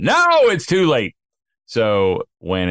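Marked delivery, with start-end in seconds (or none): 0.0-1.1 high, louder curt no; wife's curt refusal. Retold or imitated. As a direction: snap back it's too late.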